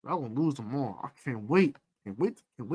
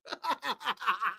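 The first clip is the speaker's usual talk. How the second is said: laugh; 11 dB quieter than usual. Real, not acted. Laughing at own bit.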